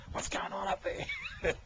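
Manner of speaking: high squeaky put-on voice, drawn out; peeping pervert. Joking as the pervert down in the bushes, squeaky mock voice. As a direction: call it out slowly in a comic falsetto.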